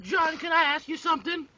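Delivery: put-on high, girlish classmate voice; sweet schoolgirl asking a favor; girl's voice. Girl in class asks John.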